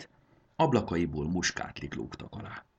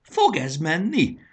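higher, louder, slow joyful call; cheerful cry; 12 semitones higher, 8 dB louder, slower than usual. Acted. Conductor cheers the dragon girl's loud whistle, jubilant. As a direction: crow it out happily. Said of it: "rikkantotta vidáman Jakab".

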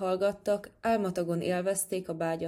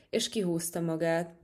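usual speaking voice

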